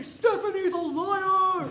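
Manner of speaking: slow loud robotic shout; TVs shouting. Retold or imitated. Imagined school TVs accusing her, loud slow shout. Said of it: "all the T Vs turn on and say".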